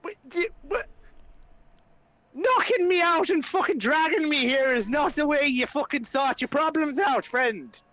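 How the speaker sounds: high-pitched exasperated rant; Flynn, accusing. Acted. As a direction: tear into him without shouting. Flynn berates Ismark for abduction.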